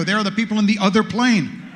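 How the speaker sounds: normal talking voice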